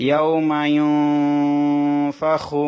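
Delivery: ইয়াউমা ইউনফাখু chanted slowly and loud, nun in the nose; slow tajweed chant; ikhfa nasal hold. Shown as a demonstration. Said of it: "সেজন্য নুন সাকিনটা গুণনা করতে হবে".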